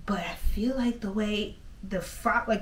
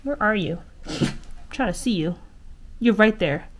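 usual speaking voice

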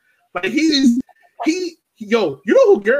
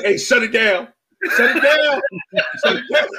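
PR rep; 5 semitones higher than usual. Acted. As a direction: act out a PR rep shutting things down. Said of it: "P R rep would have been like".

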